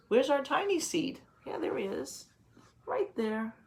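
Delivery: usual speaking voice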